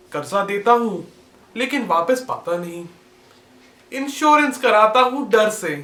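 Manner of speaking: measured Hindi poetry recital, spaced line by line; poem recitation. Acted. Young poet shares his own verse of everyday woes with viewers, measured recitation. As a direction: recite it like a poet at a competition, letting each line land.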